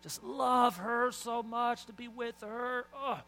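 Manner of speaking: high, drawn-out lovestruck swooning; mock gushing. Husband asked why he loves his wife, mock gushing. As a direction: gush with sighs, too smitten for words.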